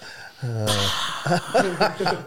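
long drawn-out mock puff of breath; exaggerated exhale. Hunter acting out breathing over and over, exaggerated exhale. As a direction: blow out a big breath for show. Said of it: "just going".

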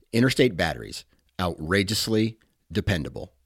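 ordinary delivery

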